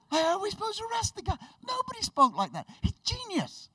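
very high, squeaky excuse; hammed-up high-pitched bafflement; baffled protest. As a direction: plead helplessly in a high voice. Guards' baffled excuse acted out.

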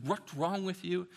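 ordinary talk

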